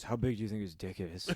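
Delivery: low hushed cop. Retold or imitated.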